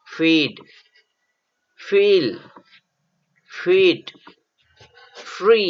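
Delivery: each word said clearly on its own, rising then falling; slow model reading of English words for learners; teacher drilling vocabulary aloud; reading a word list. Shown as a demonstration. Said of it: "असा उच्चार होणारे शब्द".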